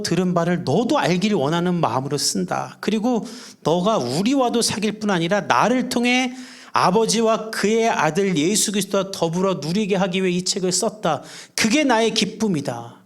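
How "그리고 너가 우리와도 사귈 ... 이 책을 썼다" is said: ordinary talk, nothing marked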